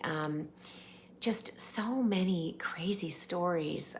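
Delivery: usual speaking voice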